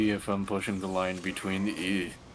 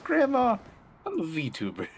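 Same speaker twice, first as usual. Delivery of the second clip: mock protest; 12 semitones higher than usual. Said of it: "And I'm like".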